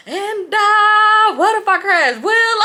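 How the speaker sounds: high, loud, drawn-out hollering; crazed high-pitched taunt, very loud; wild retold yell. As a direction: holler it like a reckless driver taunting passengers. Acts out reckless driver yelling.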